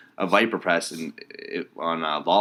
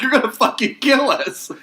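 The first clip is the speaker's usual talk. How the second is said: mock panic; 8 dB louder, slower than usual. Mocking friend's panicked warning.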